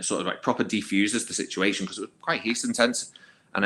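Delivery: usual speaking voice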